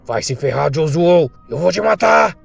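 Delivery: furious shout climbing to a high, loud threat; angry outburst. Acted. Enraged man threatening his rival Josuo, angry yell. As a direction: build from curse to yelled threat. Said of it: "já ficando com raiva".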